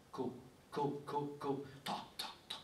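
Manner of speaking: "co, co, co, co, ta, ta, ta" as separate even beats; hammered syllables. Shown as a demonstration. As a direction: hammer out each "co" and "ta" on its own. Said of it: "on martèle".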